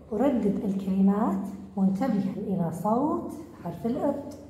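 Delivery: ordinary talk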